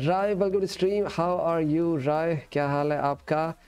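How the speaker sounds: ordinary delivery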